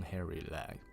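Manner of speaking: plain reading voice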